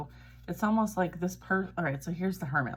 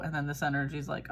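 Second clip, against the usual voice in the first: normal talking voice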